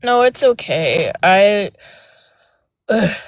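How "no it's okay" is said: normal talking voice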